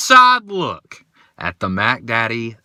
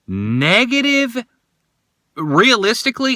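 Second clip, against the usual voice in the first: incredulous; 12 semitones higher than usual. Real, not acted. Disbelief at cold temperature.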